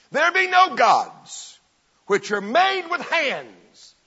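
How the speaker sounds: loud, high, slow proclamation; Paul's battle-axe line. Retold or imitated. Apostle preaching his line against idols, slow shout. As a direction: thunder it out word by word. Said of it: "What was Paul's line".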